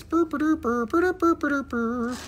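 high silly rolled burr sounds; playful trill. Real, not acted. Seller making silly trilling noises while showing a crystal. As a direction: trill like a happy little bird.

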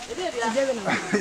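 short high laugh; a quick laugh at the name; light laugh. Real, not acted. Guide laughing while naming the tree, light chuckle.

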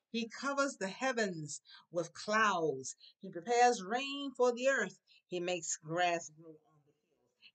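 slow, projected reading of a verse; scripture recitation. Real, not acted. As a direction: proclaim each phrase slowly to a class. Reads scripture to the class.

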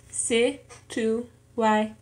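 slow "situáis" in four separate pieces; syllable by syllable. Shown as a demonstration. Learner showing four syllables in an exercise, "situáis" syllable by syllable. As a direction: break "situáis" up as si, tu, a, is. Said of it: "en cuatro sílabas".

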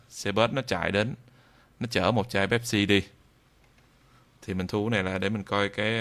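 ordinary delivery

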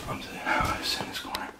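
frightened whisper from the corner; hiding whisper. Real, not acted. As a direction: whisper so you won't be found.

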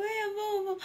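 put-on high child voice to daddy; a small girl's mild whine before tears; whining little girl. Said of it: "قالت له". (صوت طفلة قربت تعيط)

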